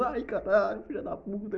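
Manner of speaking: high-pitched amazed cry; high excited cry. Retold or imitated. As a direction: squeal it in disbelief.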